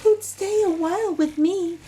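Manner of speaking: a lonely spider dreaming aloud of guests; gently hopeful, a touch sad; wistful longing. A narrator's reading. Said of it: "she sighed".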